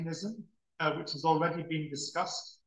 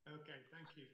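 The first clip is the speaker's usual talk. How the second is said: faint remote line; far-off voice over the call; barely audible; 25 dB quieter, faster than usual. Real, not acted. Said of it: "a bit better".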